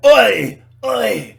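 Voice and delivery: sudden high, loud yell; short, explosive mock holler; mock outburst. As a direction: bellow it out sharply.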